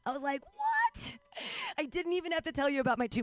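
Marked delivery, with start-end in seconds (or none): 0.5-1.1 squeaky high shocked "What?". Retold or imitated. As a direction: jump way up in disbelief. Shocked reaction to compliment.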